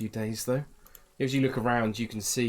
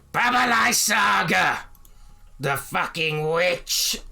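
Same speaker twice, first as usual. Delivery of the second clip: high, loud, slow furious yell; furious shout; 8 semitones higher, 7 dB louder, much slower than usual. Acted. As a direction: bellow the name out in rage from the tent. NPC bursts out of tent.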